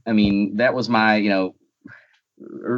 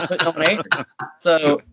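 chuckling, pitched up; laughing; 5 semitones higher than usual. Real, not acted. Guest joking about British writers, laughing. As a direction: say it through a laugh.

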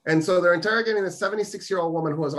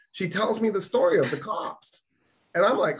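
ordinary delivery

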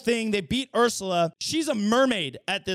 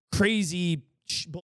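ordinary talk, nothing marked

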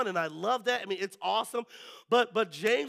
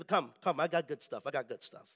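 low Jamaican hustler, getting softer; rusty Jamaican accent; 7 semitones lower, 4 dB quieter than usual. Acted. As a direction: pitch the goods quietly in a put-on Jamaican accent. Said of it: "a little rusty on my Jamaican ask, uh, uh, accent".

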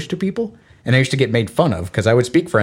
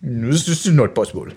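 exasperated French speaker; 3 semitones higher, 3 dB quieter, much slower than usual. Retold or imitated. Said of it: "And they're like".

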